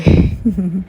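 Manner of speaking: short burst of laughter; loud giggle. Real, not acted. Laughs after saying he was sweet.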